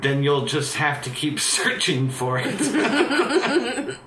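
slow, high, laughing through it; laughing tease. Acted. Hero taunting the woman, laughing slowly. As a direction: tease her while cracking up.

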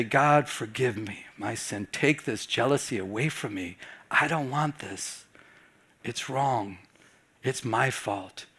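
hushed repentant prayer to God; humble prayer. Retold or imitated. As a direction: pray it quietly, broken and contrite.

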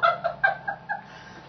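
high cackling laugh; orchestra laughing. Acted. Whole orchestra laughing at Tubby, high cackle. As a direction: laugh in high, rapid bursts. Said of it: "began to laugh".

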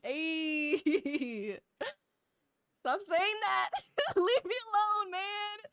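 high, loud whine through laughter; laughing protest. Real, not acted. Streamer tells teasing chat to stop, laughing protest. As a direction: plead with them while cracking up.

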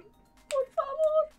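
por favor drawn out very slow and high; desperate plea. Real, not acted. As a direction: stretch favor high in despair.

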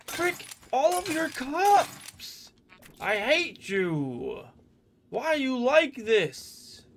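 high, slow, drawn-out complaint; exasperated rant. Real, not acted. Gamer cursing the game's scares, high and slow. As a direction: stretch each word out in frustration. Ranting at the game.

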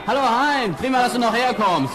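calling out a greeting. Acted. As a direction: call him over cheerfully. Friend arrives, called across pub.

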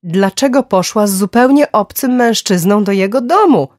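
loud, high, alarmed demand; horrified and furious. A narrator's reading. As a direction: call it out in angry panic. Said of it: "przerażony i wściekły".

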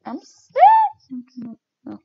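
ordinary delivery

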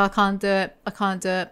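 usual speaking voice

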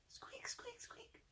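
barely audible high squeaks; mouse squeak. Shown as a demonstration. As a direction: squeak as quietly as a tiny mouse.

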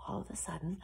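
secretive storyteller; hushed suspense. A narrator's reading. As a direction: drop to a hush before the surprise. Suspense before the shepherd calls.

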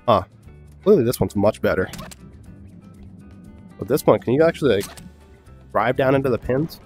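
ordinary delivery

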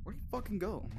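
high anxious outburst; panicked searching; scared horror game streamer. Real, not acted. Searching frightened.

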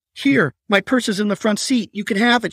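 high, frightened pleading. Acted. Patricia begging her abductor, frightened and pleading. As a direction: beg him to take the purse, voice up high. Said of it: "she pleaded".

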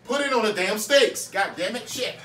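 loud, high, fed-up shout; exasperated yell. Real, not acted. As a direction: bark the order at them. Yelling at helper about steaks.

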